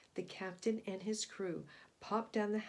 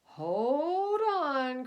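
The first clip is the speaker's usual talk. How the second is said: louder warning cry; captain's cry; 4 semitones higher, 7 dB louder than usual. A narrator's reading. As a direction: call out to stop the cheering crew. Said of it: "cried the captain".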